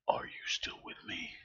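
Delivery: eerie, breathy put-on whisper; mock whisper. Streamer asking if chat is still there, mock whisper. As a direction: whisper it as if calling into the void.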